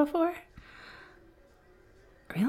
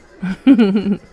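a burst of open laughter; loud laugh; 13 dB louder than usual. Acted. Bartender laughs loudly at her own cheeky joke. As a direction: laugh hard at your own flirty joke.

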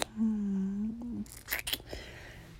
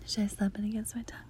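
quick teasing whisper; hurried playful whisper; 5 dB quieter than usual. Acted. Whispers throughout, intimate.

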